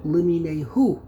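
"Limine hu" with the weight on HU; stress on last syllable. Shown as a demonstration. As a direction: land hard on the final "hu" of "Limine hu". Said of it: "at the very last syllable".

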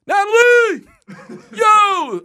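high, loud call across the street. Retold or imitated. Calling to the boss across the street, loud and high. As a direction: yell her name across the street. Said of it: "I'm like".

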